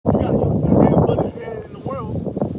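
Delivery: loud, slow scolding shout; preaching rebuke. Real, not acted. Preacher scolding a brother who follows worldly friends, loud and slow. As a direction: call him out hard and slow.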